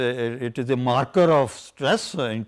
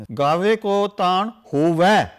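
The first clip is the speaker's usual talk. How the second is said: "Gaave ko taan hove" drawn out and louder; slow emphatic recitation; 3 semitones higher, 5 dB louder, much slower than usual. Shown as a demonstration. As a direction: draw out every word, end on "hove". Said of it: "You see".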